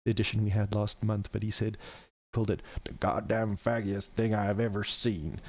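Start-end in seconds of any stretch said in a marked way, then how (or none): 2.3-5.4 a clumsy put-on American accent, Nixon-style; bad American accent. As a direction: fake a rough American accent quoting Nixon. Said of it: "that was a terrible American accent".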